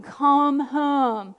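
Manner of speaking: mild, drawn-out imitation of yelling; retold yell. Jesus yelling from the gate, mild retold yell. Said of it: "He was yelling".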